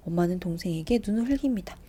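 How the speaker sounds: ordinary delivery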